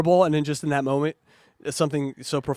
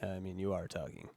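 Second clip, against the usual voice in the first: ordinary delivery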